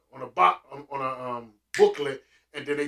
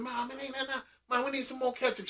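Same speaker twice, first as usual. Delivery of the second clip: put-on higher, softer kid whine; kid pleading; 8 semitones higher, 8 dB quieter, faster than usual. Son begging mom for ketchup, small whine. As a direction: beg like a little boy. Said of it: "I said".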